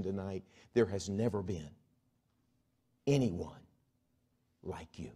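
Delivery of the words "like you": ordinary talk, nothing marked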